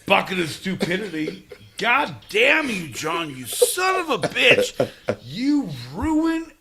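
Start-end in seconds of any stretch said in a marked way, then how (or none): 1.8-6.5 mock angry rant. Real, not acted. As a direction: curse him out, dragging the words. Angry drunk rant at John.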